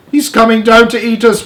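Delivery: high, loud wail of alarm; wailing grasshopper. A narrator's reading. Grasshopper wailing to friends about the cloudman. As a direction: wail it as a frightened old insect. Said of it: "wailed the old green grasshopper".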